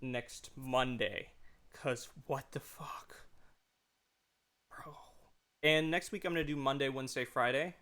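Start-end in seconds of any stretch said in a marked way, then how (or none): 1.7-4.9 breathy whisper, slowed right down; whispered aside. Real, not acted. Streamer confiding to viewers about the game, slow whisper. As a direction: hush it like a secret to the chat.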